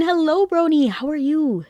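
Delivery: sweet, lilting welcome; singsong greeting. Real, not acted. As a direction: greet them in a high lilt.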